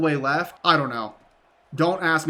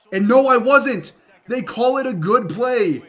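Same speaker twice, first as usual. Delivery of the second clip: higher and louder burst of disbelief; surprised relief; 6 semitones higher, 6 dB louder than usual. Real, not acted. Gamer hearing the booth review go his way, surprised. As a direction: react like you got away with it.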